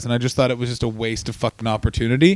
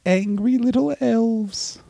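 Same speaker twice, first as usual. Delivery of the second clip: drawn out punchline; 7 semitones higher, much slower than usual. Acted.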